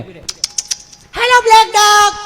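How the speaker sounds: mock lady cooing at a dog; sing-song greeting.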